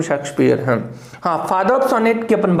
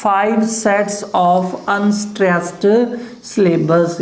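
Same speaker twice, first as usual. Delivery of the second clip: drawn out very slowly, each word spaced; slow dictation; much slower than usual. Shown as a demonstration. Teacher dictating the definition to students, very slow. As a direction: spell it out slowly for note-taking. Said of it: "teacher dictating definition".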